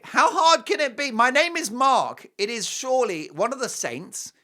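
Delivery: high, loud, indignant outburst; mock exasperation. Host protesting his misspelt name, mock exasperation. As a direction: protest loudly, hammed-up.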